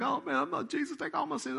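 high, hammed-up bawling prayer; mock crying. Ten-year-old convert praying beside the preacher, mock crying. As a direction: imitate a child wailing out a prayer. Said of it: "I'm crying like".

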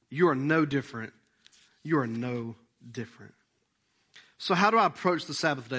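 ordinary delivery

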